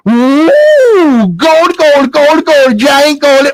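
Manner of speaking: high, gleeful gold-struck squeal; excited awe. Acted. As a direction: go wild at the sight of treasure. Mother sees the gold.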